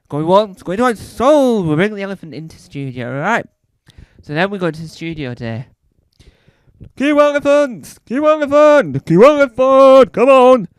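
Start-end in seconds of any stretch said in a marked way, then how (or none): 0.1-1.7 mock auction call. Mock auctioneer selling the idea. 7.0-10.7 high, loud yelled cues into the studio; panicked floor manager yelling cue elephant; frantic director shouting. Acted.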